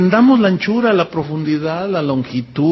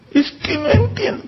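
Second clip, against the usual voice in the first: whiny complaint; 8 semitones higher, 4 dB quieter than usual. Retold or imitated. Mocking the confused believer.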